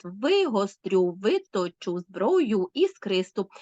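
slow triple beat, first syllable of "вигострю" and "виточу" stressed; dactylic scansion. Shown as a demonstration. Teacher demonstrating dactyl, slow waltz stress on "виточу зброю". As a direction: stretch the first of every three syllables in "виточу зброю іскристу". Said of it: "з наголосом на першому складі".

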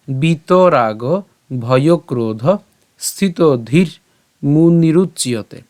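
drawn-out words, spaced by pauses; slow model recitation. Shown as a demonstration. Teacher models the verse's second half, slow measured recitation. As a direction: recite slowly, piece by piece.

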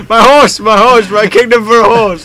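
loud, high hammy stage king; theatrical king. Pretend. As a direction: declaim it like Richard III. Theatrical Shakespeare quote.

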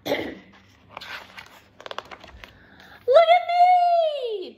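high, loud show-off call; excited cry. A narrator's reading. Douglas showing friends his new hat, excited high cry. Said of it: "Douglas cried".

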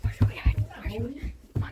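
hushed whisper under the covers; whispering while hiding. Real, not acted. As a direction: whisper so nobody hears. Hiding during hide and seek.

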